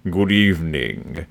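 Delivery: low, slow, deadpan TV host greeting; a macabre host welcoming viewers; slow, low droll greeting; Alfred Hitchcock impression. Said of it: "If you ever hear Alfred Hitchcock talk".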